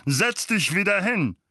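high loud command; furious uncle orders Harry to sit; angry order. A narrator's reading. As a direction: bark the order.

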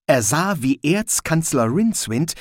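ordinary delivery

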